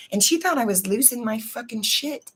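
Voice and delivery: usual speaking voice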